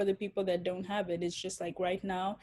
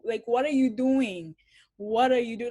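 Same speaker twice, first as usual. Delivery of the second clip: retold outraged confrontation, louder; appalled, raised-voice scolding; indignant challenge; 3 semitones higher, 6 dB louder than usual. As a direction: confront the attackers in disbelief. Confronting attackers in retelling.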